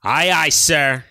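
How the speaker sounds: slow, drawn-out mock salute; sarcastic aye aye. Sergeant sneers back at lieutenant, slow sarcastic drawl. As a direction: stretch it out with contempt.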